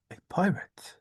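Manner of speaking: soft unvoiced whisper; whispered guess. Player whispering a guess at the clue, unvoiced.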